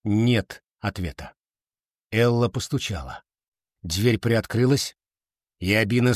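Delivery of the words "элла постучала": plain reading voice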